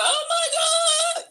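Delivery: long, high-pitched mock scream; hammed-up wail. On the floor as the mall cop arrives, hammed-up wail. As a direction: draw out a fake victim's cry. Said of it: "I'm on the floor".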